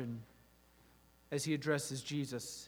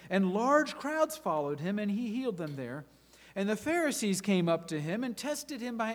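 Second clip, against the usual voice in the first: lively, dramatic Bible reading; scripture reading; 3 semitones higher, 5 dB louder than usual. Acted. A preacher reading Matthew to his congregation, dramatic. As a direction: read the parable like a story to the church.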